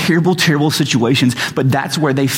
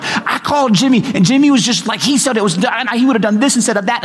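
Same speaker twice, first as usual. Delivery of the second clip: frantic rant; 7 semitones higher, faster than usual. Retold or imitated. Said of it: "I'm like".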